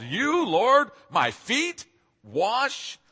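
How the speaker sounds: aghast, drawn-out rising question; gobsmacked and appalled. Retold or imitated. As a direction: Peter can't believe his Lord would stoop to this. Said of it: "he's gobsmacked and a little appalled".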